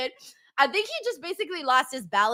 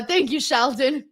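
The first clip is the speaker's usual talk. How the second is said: ordinary delivery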